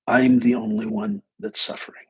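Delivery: ordinary delivery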